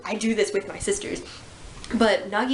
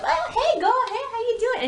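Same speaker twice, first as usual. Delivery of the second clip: much higher, bright teasing hello in a put-on voice; chirpy greeting. Nagisa greets Rin's sister with a chirpy teasing hello. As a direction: sing out the greeting. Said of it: "So she goes up".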